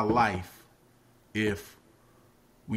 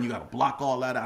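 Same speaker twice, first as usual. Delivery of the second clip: ordinary talk, nothing marked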